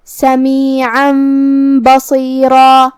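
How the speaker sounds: سمیعا بصیرا chanted slowly, tanween becoming a held hummed m; tanween turned to meem. Shown as a demonstration. Teacher demonstrating the change to meem, slow nasal chant. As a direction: hum a long m between سمیعا and بصیرا. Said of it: "تنوین کو میم میں بدل کے غنہ".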